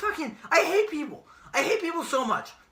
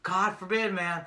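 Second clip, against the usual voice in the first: ordinary delivery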